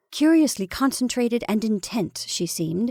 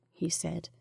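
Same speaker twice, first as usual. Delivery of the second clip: plain reading voice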